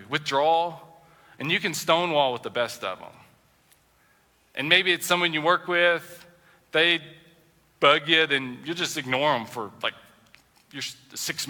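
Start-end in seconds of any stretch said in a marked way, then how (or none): none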